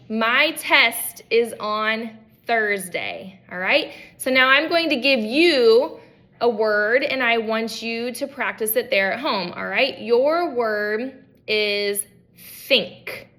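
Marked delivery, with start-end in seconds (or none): 0.1-3.4 "My test is on Thursday" said very slowly, with a clear unvoiced th in "Thursday"; slow model sentence. Shown as a demonstration. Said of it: "So I could say something like". 10.0-13.3 "think" drawn out very slowly with a clear unvoiced th; slow word announcement. Shown as a demonstration. Therapist giving the student a practice word, very slow. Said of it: "think about the word think".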